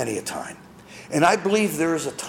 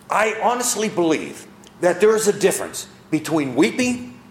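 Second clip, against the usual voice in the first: normal talking voice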